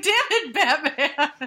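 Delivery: high laughing cry of frustration; half-laughing, half-wailing at Batman; laughing mock outrage. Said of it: "And I was like".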